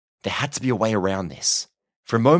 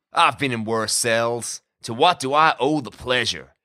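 slow, drawling American prisoner, pitched up; smirking American cowboy; 3 semitones higher, slower than usual. A narrator's reading. Jailed cowboy receives his visitor, smugly amused. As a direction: lounge and taunt in an American drawl.

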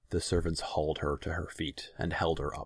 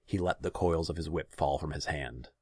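ordinary delivery

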